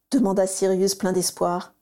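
ordinary delivery

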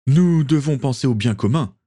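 lightly voiced governor defending himself; justifying. A narrator's reading. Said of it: "justifia le gouverneur".

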